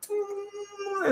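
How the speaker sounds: high, very soft drawn-out hum; aye-aye eating noise. Acted. Host as an aye-aye reaching for a larva, high soft creature noise. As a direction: imitate a creature probing with a finger. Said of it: "impersonation of an aye-aye eating".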